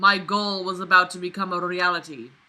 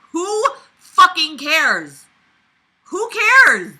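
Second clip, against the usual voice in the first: high, loud, slow who cares; fed-up yelling at the book; exasperated shout; 10 semitones higher, 11 dB louder, much slower than usual. Real, not acted. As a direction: yell it in disbelief. Ranting at the narrator.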